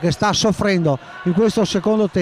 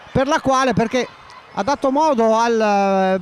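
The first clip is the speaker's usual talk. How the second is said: usual speaking voice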